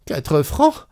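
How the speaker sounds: startled, high-pitched disbelief; a sudden gasp of outrage at Quatre francs; shocked. Acted. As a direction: jolt up at the price. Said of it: "Elle eut un sursaut".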